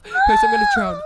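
hammed-up panic cry; mock wailing. Player panics about drowning.

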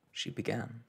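plain reading voice

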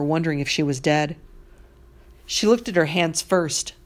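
plain reading voice

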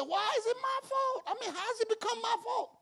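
high, incredulous why is it my fault; indignant protest. Retold or imitated. Preacher retelling his protest to God, incredulous high. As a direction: pitch way up in disbelief.

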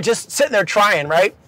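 ordinary delivery, nothing marked

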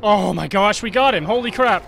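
loud triumphant cheer; excited. Real, not acted. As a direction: shout with the thrill of victory. Boss just killed in game.

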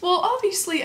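ordinary talk, nothing marked

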